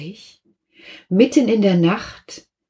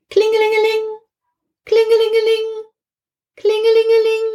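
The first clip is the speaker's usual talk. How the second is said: high ringing voice; phone ring; much faster than usual. Acted. The telephone rings again in the night, high ringing.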